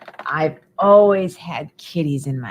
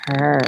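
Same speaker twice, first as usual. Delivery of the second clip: low purr; 3 semitones lower than usual. Acted. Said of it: "you might hear him".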